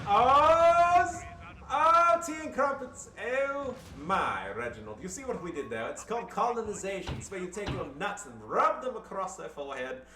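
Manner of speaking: high, loud hammed-up posh Englishman; mock a pompous colonial toff; over-the-top upper-class Brit. Mocking British colonizer while playing Brits.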